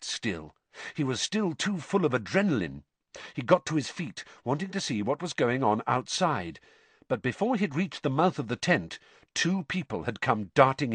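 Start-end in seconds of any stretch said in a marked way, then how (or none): none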